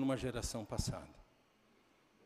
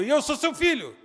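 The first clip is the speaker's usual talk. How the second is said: acted angry shout; 12 semitones higher, 12 dB louder than usual. Son retold shouting back.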